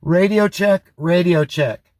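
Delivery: normal talking voice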